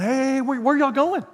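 high, eager call after departing friends. Retold or imitated. Pastor acting himself calling out to friends leaving church, high and eager. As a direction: sing it out, hoping to be invited.

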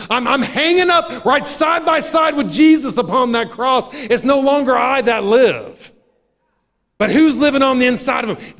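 high, loud, fervent preaching voice; full-force preaching. Acted. Preacher urging the church to take off the old man, shouting. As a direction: preach it out at the top of your lungs.

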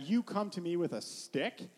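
ordinary talk, nothing marked

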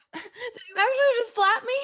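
shocked disbelief; outraged after the slap; stunned. Acted.

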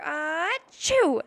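loud high sneeze, bursting out; big sneeze. A narrator's reading. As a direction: let a huge achoo explode. King finally sneezes in story.

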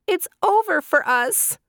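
frightened little doves in high voices; doves crying out. A narrator's reading. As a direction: wail that all is lost. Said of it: "the doves cried out".

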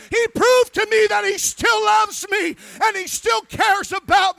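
high, loud shout, slowing at the end; testimony shouted. Retold or imitated. Preacher reliving his weeping to Sister Skiles, shouting. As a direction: cry it out to the rafters.

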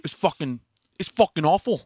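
outraged celebrity chef, building to a loud high yell; Gordon Ramsay. Acted. TV chef tearing into the food on the table, outraged, exploding. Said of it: "Gordon Ramsay".

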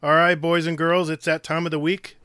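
put-on booming kids show opener. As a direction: hype up the kids like a TV host. Host opens the community segment.

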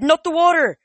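high, loud hammed-up panic; fake alarm. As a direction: pretend to be scared. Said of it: "I said".

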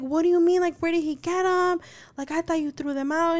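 high, incredulous questioning; retold freak-out. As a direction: replay a panicked parent demanding answers. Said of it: "freaking out".

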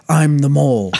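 mock villain reveal. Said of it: "literally says".

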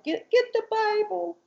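lightly voiced high child, repeating the request; a hint of a small girl asking twice; mother imitating her daughter's childlike voice; little girl voice. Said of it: "she's talking like this".

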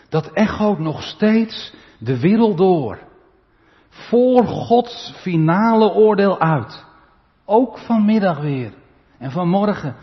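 loud, slow, high proclamation; preaching at full force. Acted. Preacher proclaims the angel's cry to the church, loud and slow. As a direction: ring it out like an echo.

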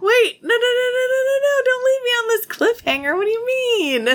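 very high, loud squealed pleading; mock panicked protest. Reader begs not to be left on cliffhanger, mock panic. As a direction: plead frantically in an exaggerated squeal.

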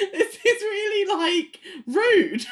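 high, laughing mock outrage. Viewer reacting to John leaving his girlfriend, high laughing outrage. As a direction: scoff at John's rudeness. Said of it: "I'm just like".

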